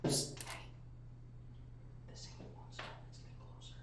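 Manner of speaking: unvoiced in-game whisper. Whispering a warning to a sleeping party member, whisper. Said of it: "I don't know why I'm whispering".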